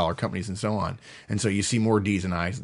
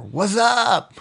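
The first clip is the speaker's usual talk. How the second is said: higher, slangy "What's up?" tossed off; casual slang greeting; 9 semitones higher than usual. Retold or imitated. A slangy high I greeting, casually tossed "What's up?". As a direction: throw out "What's up?" like a buddy. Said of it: "things like".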